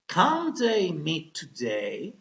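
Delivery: "Can they meet today?" said slowly with a clear rise at the end; question intonation. Shown as a demonstration. Teacher models question intonation for students, slow and rising. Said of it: "muy importante dar la entonación de una interrogación".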